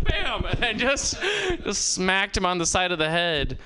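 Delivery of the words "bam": sharp high "Bam!" for the frying pan blow; mimicked frying pan hit. Wife smacking husband with pan, sound effect. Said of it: "she was like".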